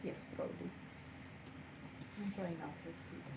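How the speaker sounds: near-silent whisper; hardly voiced, very soft; barely audible. Real, not acted. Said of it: "I can barely hear you".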